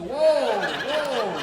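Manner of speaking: loud, high, laughing shock; startled whoa. Retold or imitated. Startled man reacting to the old lady's request, loud and high. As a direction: yelp it out, caught off guard.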